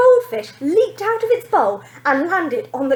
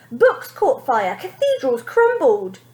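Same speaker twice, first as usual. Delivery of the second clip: ordinary delivery, nothing marked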